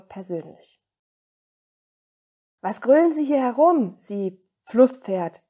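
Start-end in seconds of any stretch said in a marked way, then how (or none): 2.6-5.4 loud, higher-pitched scolding; indignant call. A narrator's reading. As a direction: opera director loudly telling off an intruder. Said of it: "rief er". (empörter, lauter Ausruf)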